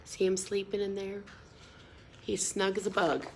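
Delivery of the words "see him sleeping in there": ordinary delivery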